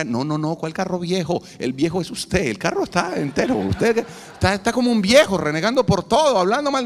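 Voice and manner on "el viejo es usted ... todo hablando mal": preaching rebuke. Real, not acted. Preacher scolding grumpy fathers.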